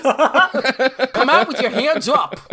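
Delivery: high, loud, drawn-out cartoon hunter; hammed-up hunter calling out a slow order; Elmer Fudd. Cartoon lawman at a standoff, high, slow mock shout. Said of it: "could you imagine him going".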